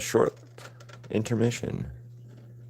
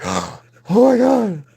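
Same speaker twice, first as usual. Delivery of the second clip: starstruck gasp; 3 dB louder, much slower than usual. Retold or imitated. Fan gasps on recognizing him.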